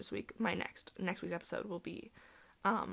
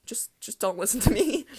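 jokey loud plea; 3 semitones higher, 10 dB louder than usual. Real, not acted. Joking plea to listeners.

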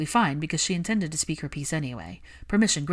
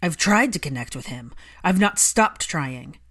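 furious Malfoy; 6 dB louder than usual. Acted. Malfoy furious at Hermione for mentioning his wife. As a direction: hurl it back at her.

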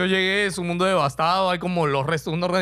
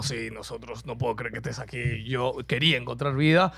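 alien god voice; 9 semitones lower, 6 dB quieter than usual. Retold or imitated.

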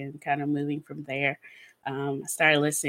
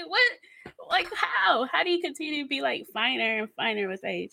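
higher, wondering what and how; high amazed disbelief; 7 semitones higher than usual. Retold or imitated. Gushing about the actor, high amazed disbelief. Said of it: "I'm just like".

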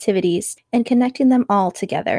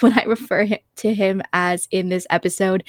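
ordinary talk, nothing marked

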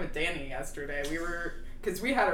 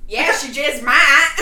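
slow, loud Tiger King drawl; 7 semitones higher, 12 dB louder than usual. Acted. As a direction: belt it like Joe Exotic. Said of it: "Our Tiger King voice".

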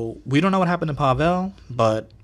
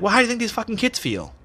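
mom snapping back; 10 semitones higher, faster than usual. Retold or imitated. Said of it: "my mom was like".

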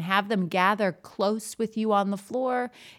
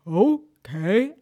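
loud chorus okay; class answering; 3 semitones higher, 6 dB louder than usual. Retold or imitated. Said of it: "And they all say".

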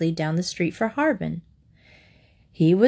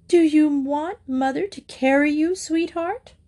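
doting mother; 8 semitones higher than usual. A narrator's reading. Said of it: "rather hysterical embrace".

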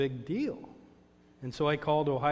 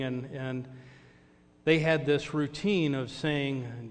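ordinary delivery

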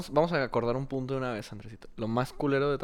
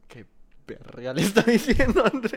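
higher, louder, slowed bewildered outburst; baffled; 9 semitones higher, 6 dB louder than usual. Retold or imitated. New agent hearing a caller he can't understand, bewildered high outburst. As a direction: sound totally lost at what the caller said. Retelling his own confusion on call.

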